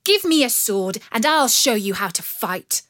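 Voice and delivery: loud defiant demand; a fierce girl daring him; fiery challenge. Acted. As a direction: Marian defying the bandit, loud and bold. Marian challenges the bandit.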